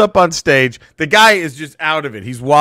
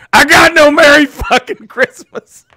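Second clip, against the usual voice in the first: loud, high, anguished holler; full-voiced acted outburst; outraged shout; 11 semitones higher, 8 dB louder than usual. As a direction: bellow it like an aggrieved drunk on stage. Acts out enraged drunk man.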